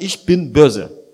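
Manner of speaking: slow and loud, every word hammered; loud declaration. Retold or imitated. Preacher voicing a person who chooses sin, slow loud declaration. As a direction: declare it defiantly, as if proud.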